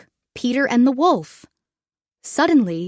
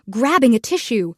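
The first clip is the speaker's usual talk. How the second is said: usual speaking voice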